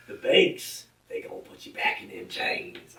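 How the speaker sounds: put-on Southern-accent parody, high start dropping to hoarse hush; mock a pandering politician in a Southern accent; Joe Biden. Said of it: "He doesn't talk like that".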